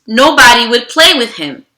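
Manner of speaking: usual speaking voice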